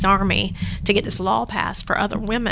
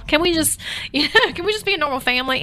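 higher-pitched pleading, as a retold daughter; imitated child's plea; 7 semitones higher than usual. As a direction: plead lightly like a fed-up child. Voices her daughter's plea.

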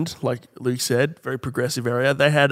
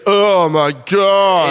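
slow, drawn-out mock groan; 4 semitones higher, 5 dB louder, much slower than usual. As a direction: stretch it out like someone suffering on the toilet. Mimicking toilet groan.

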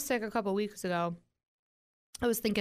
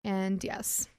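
normal talking voice

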